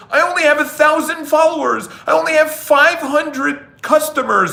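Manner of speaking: high, put-upon excuse-making voice; a hint of a complaining beginner; whiny complainer. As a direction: mimic someone making excuses, lightly whiny. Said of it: "when someone says".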